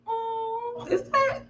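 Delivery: high, soft, questioning hum; doubtful hmm. Retold or imitated. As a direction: let the doubt hang up high and quiet. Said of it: "I was like".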